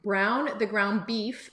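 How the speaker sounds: "Brown the ground beef" slowed down, each word placed clearly; deliberately slowed "Brown the ground beef" after the slip; careful correction. Shown as a demonstration. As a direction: say "Brown the ground beef" slowly to get it right.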